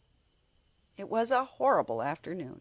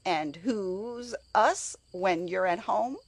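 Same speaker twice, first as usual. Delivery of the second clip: scornful; 3 semitones higher, 4 dB louder, slower than usual. A narrator's reading. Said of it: "asked Martha scornfully".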